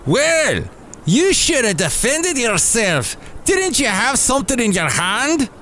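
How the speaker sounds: hammed-up Scottish voice; Scottish-sounding. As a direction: pitch it up in a put-on Scottish brogue. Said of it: "even though he's Scottish sounding".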